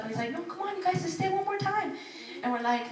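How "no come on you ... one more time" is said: a gentle, wheedling plea; pleading dad. Retold or imitated. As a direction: coax them to stay one more night. Father begging.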